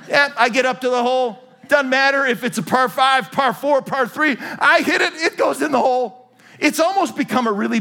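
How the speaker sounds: high, loud bragging build to a shout on I hit it; mock boast. Preacher pretending to brag about golf, loud and excited. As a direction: crow about every shot going in.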